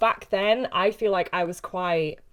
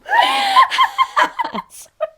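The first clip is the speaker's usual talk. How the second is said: high, loud shrieking laugh; 10 dB louder than usual. Real, not acted. Shrieking with laughter at her own joke. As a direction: let out a big squealing laugh.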